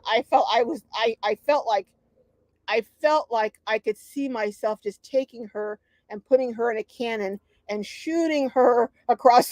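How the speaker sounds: ordinary talk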